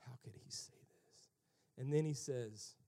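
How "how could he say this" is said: hushed, low whisper; whispered disbelief. Acted. Pharisees reacting to Jesus' words, whispering. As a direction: whisper it as a scandalized aside.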